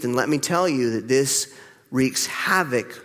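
ordinary talk

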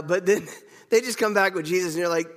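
usual speaking voice

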